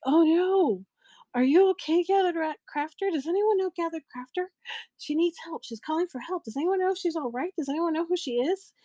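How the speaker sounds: high, worried mimicry of a host; imitated panic. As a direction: act out alarm, pitched well up. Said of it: "And she's panicking, poor thing".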